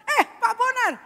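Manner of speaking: high, loud reply hello; exclaimed high-pitched greeting; booming greeting. Acted. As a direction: call out the name in welcome. Pastor greets the father back.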